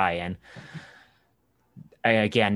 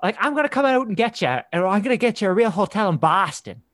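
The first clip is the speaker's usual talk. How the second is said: much higher, fast and louder Boston local, pushy; Boston accent; 11 semitones higher, 4 dB louder, much faster than usual. Retold or imitated. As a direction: insist like an eager drunk Bostonian, fast and high. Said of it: "he's like".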